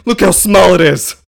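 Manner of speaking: high, loud mock insistence; mock Lex. Lex showing the doctor the glass, high loud mock voice. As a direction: shove the proof forward loudly.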